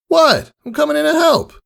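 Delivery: high, brash and friendly; cocky praise from a hero; bold tomboy mare voice. Acted. Rainbow Dash praising Scootaloo after the fight, brash and friendly.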